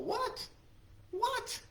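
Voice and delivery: two high, squeaky rising whats; mock disbelief. Teacher to class, mocking the insult with high disbelief. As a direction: act stunned by the insult.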